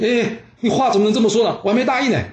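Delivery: high, startled objection with a laugh; protest, caught completely off guard; laughing, high-pitched denial; flustered protest. Acted.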